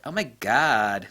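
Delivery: put-on slow drawn-out Valley girl Oh my God. Vlogger mocking himself with a slow Valley girl Oh my God. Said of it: "talking like a Valley girl".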